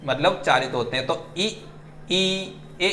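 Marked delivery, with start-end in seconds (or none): 1.4-2.9 ई and ए held out, clearly shaped; vowel demo. Shown as a demonstration. Teacher showing her mouth shaping ई, ए slowly. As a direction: sound out ई then ए for the class. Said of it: "आप मेरा जो है mouth देख सकते हैं".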